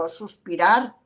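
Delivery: ordinary talk, nothing marked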